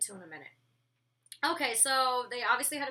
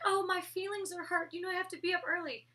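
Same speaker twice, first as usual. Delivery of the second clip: lightly voiced whiny roommates, higher; mocking whiny roommates; 7 semitones higher than usual. Mocking roommates for waking them: lightly whiny, higher. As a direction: put on a hint of whine, mocking. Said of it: "Rima and Rocky were like".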